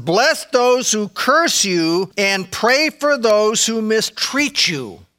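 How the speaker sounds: slow, deliberate scripture reading with weight on curse. Acted. To the congregation, quoting Jesus slowly and deliberately. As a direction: lay each word down slowly.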